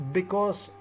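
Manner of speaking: ordinary delivery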